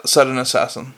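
plain reading voice

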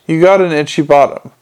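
usual speaking voice

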